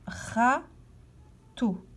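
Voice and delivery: "râteau" in two separate beats, pause between them; syllable by syllable. Shown as a demonstration. Teacher asking where ra is heard, "râteau" syllable by syllable. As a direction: stretch "râteau" apart at the syllable break. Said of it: "À quel endroit entend-on la syllabe".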